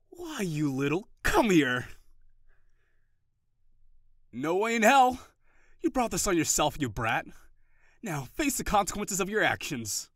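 loud, high mock-threatening yells while chasing; playful shouting. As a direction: pretend vengeance in a flour fight. Playful flour fight chase.

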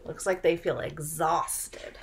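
usual speaking voice

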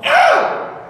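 a sharp high yelp of shock; high startled "ah". Retold or imitated. Mocking a hearing reaction with a high startled "ah". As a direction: gasp out a high "ah". Reacts to a deaf reader's phrase.

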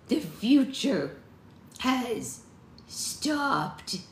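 slow, high crone voice, halting; shallow-breathed seer. A narrator's reading. Maud, failing, tells the witches her vision, halting. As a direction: break the line into laboured, ominous pieces. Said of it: "Her breathing seemed shallow".

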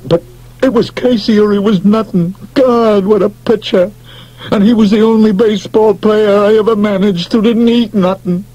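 high pleading confession; plaintive. Acted. McGarry confesses to Beasley, plaintive. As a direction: beg him to understand. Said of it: "he said plaintively".

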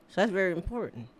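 usual speaking voice

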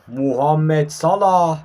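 name stretched very slowly; drawn-out commentator call. Real, not acted. Calling out Salah's run, drawn-out excited call. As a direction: build into a long match-commentary call.

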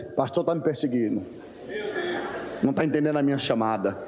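low, muttered complaint; grumbling member. Retold or imitated. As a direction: grumble like an offended churchgoer. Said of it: "quem tem dificuldade de entender ... diz".

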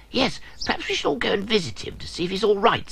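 fast, high fretting; concerned Dip. Acted. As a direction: suggest visiting kindly. Dip voiced, worried.

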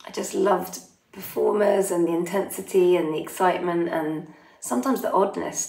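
usual speaking voice

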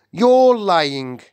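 loud, high accusing cry; indignant cry. A narrator's reading. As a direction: cry it out in outraged disbelief. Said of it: "he cried".

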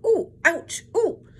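loud, high yelps of "ooh" and "ouch"; high pained shriek. A narrator's reading. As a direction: shriek in short, high bursts.

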